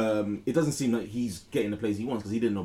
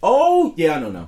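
surprised realization; 7 semitones higher, 13 dB louder, slower than usual. Real, not acted. Sudden recognition of player.

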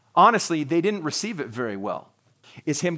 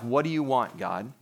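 bad attitude; 5 semitones lower, 6 dB quieter than usual. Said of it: "saying it with a bad attitude".

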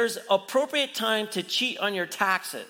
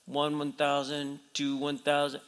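low, soft deadpan count; rules official counting; 6 semitones lower, 4 dB quieter than usual. Retold or imitated. Preacher playing the rules official timing the ball, low quiet count.

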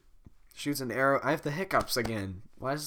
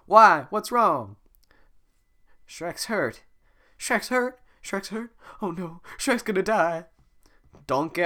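high, loud, panicky talking donkey; frantic cartoon donkey; Donkey impression; 10 semitones higher, 7 dB louder than usual. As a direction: fuss over a hurt friend in a frantic high voice. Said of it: "most genuine donkey impression".

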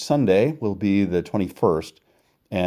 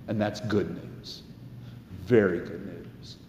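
soft warm; 9 dB quieter than usual. Acted. Soft closing affirmation.

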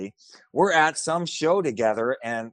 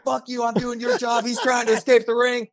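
higher, indignant mock yell; retold yelling at ref; 9 semitones higher than usual. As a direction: snap back at the referee in outrage. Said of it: "And I start yelling at the ref".

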